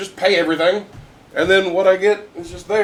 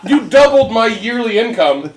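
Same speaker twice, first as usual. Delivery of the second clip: high, louder, slow indignant rant; 5 semitones higher, 5 dB louder, slower than usual. Retold or imitated. Telling lucky Bobby off in a slow, indignant rant. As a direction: spell out the injustice with mock outrage.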